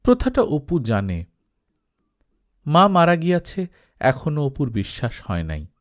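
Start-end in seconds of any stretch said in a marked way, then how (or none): none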